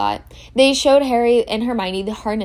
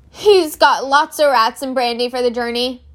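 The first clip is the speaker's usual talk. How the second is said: ordinary delivery, nothing marked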